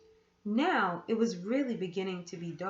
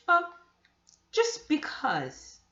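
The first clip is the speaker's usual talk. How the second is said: slow, evasive drawl; stall, you won't say why; 6 semitones higher, 5 dB louder, slower than usual. Acted. Aunt evading the question.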